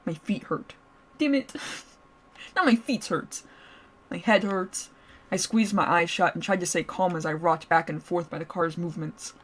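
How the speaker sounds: ordinary delivery, nothing marked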